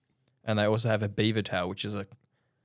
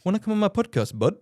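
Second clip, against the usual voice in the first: high-pitched put-on Canadian accent; Canadian version; 10 semitones higher, 4 dB louder than usual. Shown as a demonstration. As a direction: ask it like an exaggerated Canadian. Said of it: "In Canadian, I'd say".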